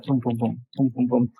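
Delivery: soft imitated heartbeat thumps; heartbeat sounds. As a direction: quietly mimic a pounding heart. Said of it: "my heart's beating fast, like".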